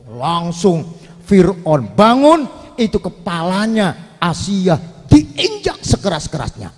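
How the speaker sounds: ordinary talk, nothing marked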